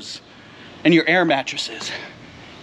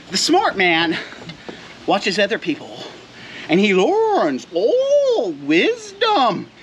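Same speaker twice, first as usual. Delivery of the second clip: mock sage; slower than usual. Mock proverb.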